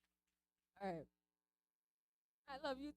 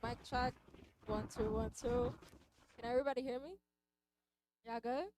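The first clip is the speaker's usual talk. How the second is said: loud mic check; 5 semitones higher, 9 dB louder than usual. Real, not acted.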